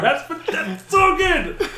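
very high, loud, drawn-out squeal with laughter; excited. Retold or imitated. Reliving seeing the hammer, high excited squeal. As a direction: gush it in a high, laughing wail. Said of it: "I was so excited".

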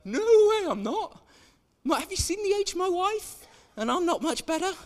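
put-on comic disbelief at having a child; incredulous old priest. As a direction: yelp it out like the idea is absurd. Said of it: "And he's like".

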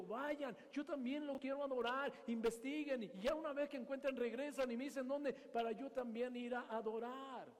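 ordinary talk

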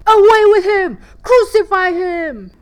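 loud, high mob shout; bay for his death; crowd crying out. Acted. The crowd crying out to Pilate, high furious shouting. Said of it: "But they cried out".

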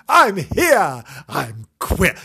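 high, loud silly entrance; hammed-up cat announcing himself; cheeky cat voice. Voicing Timbo cheekily.

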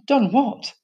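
high-pitched innocent question. A narrator's reading. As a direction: play innocent, as if clueless. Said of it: "said Shulak innocently".

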